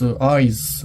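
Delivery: normal talking voice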